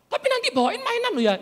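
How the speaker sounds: put-on high childlike voice; put-on small child's demand; bargaining child. Children asking father to bring toys home, high childish wheedling.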